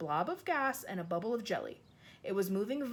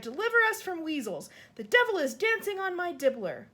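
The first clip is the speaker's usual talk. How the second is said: higher, louder desperate outcry; furious anguish; 9 semitones higher, 7 dB louder than usual. Acted. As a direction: curse the nightmare. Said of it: "filled with fury and anguish".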